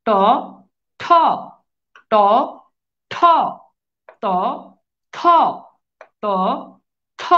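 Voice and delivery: ট and ত without breath, ঠ and থ with a puff; unaspirated and aspirated pairs. Shown as a demonstration. Teacher contrasting aspirated letters for students. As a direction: alternate plain ট and breathy ঠ, ত and থ. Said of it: "মুখ দিয়ে বাতাসের প্রবণতা বেশি".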